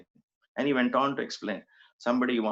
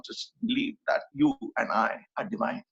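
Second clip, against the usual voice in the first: ordinary talk, nothing marked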